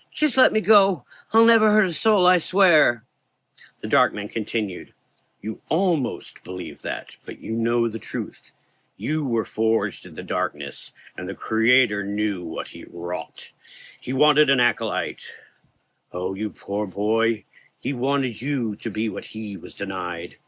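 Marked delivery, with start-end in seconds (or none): 0.1-3.0 high, loud begging; desperate plea. Acted. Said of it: "The coachman cried". 5.4-20.4 dark man voice. Acted. Dark man's slow speech.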